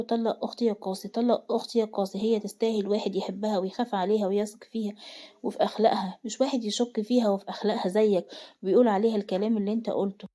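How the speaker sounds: usual speaking voice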